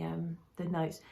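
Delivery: ordinary talk, nothing marked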